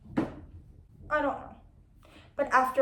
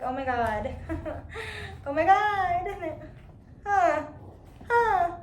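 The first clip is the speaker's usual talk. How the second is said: mock crying reader; 6 semitones higher, slower than usual. Mimicking readers breaking down over the book, mock sobbing. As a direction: ham up gasping crying readers. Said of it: "they start the book and they're like".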